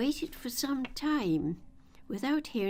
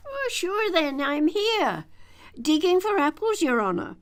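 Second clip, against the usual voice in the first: high, loud Irish servant voice; cheery Irish lilt, answering his master; Irish gardener replying to the rabbit; Irish accent; 8 semitones higher, 8 dB louder than usual. A narrator's reading. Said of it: "a voice she had never heard before".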